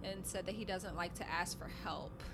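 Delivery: normal talking voice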